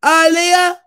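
loud, high, repeated yells of the name; shouting the name. Acted. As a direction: bellow the name again and again, half singing. Answering "say my name", calling out.

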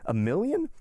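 high, rising incredulous question; scoffing disbelief. A narrator's reading. Count scoffs at the banker's sum, incredulous. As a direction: echo the sum as a laughable trifle.

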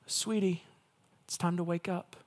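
put-on soft, tender voice to a child; gentle wake-up call. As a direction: coax a sleeping child awake. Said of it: "Like you would maybe wake your kids up".

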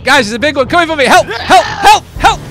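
fast, loud, high-pitched calls; panicked cry for help. Pretend. Gamer begging teammates as a big one comes, panicked yell. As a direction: yell for help in repeated short bursts.

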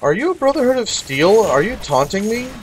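high, loud disbelief; baffled and indignant; incredulous. Real, not acted. Reacts to NPC dialogue.

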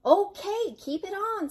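high-pitched, singsong agreement; obliging reply. Acted. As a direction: give in easily, voice swooping up high. Old woman answers the roaring bear.

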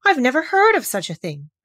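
amazed storybook protest; astonished. A narrator's reading. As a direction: exclaim it in great astonishment. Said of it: "repeated in great astonishment".

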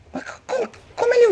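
high startled stammer; startled yelp. Real, not acted. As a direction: stammer in shock. Shock mid-fight.

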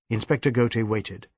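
usual speaking voice

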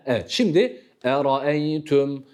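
usual speaking voice

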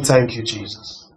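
hushed, low and drawn out; retold tearful thanks. Pastor quotes his grieving mentee, very softly. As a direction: imitate a mourner's soft, slow thanks. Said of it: "She was crying".

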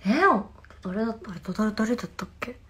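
ordinary talk, nothing marked

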